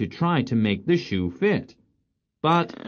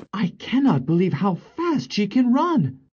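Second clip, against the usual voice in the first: higher-pitched astonished prince; amazed prince; 11 semitones higher than usual. Acted. Prince marvelling at how fast she runs, amazed. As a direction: exclaim it as the baffled prince.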